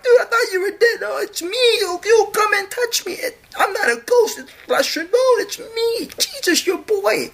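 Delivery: high, laughing dude voice; high giggly mockery; mock Jesus bro voice. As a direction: ham it up like a goofy homeboy.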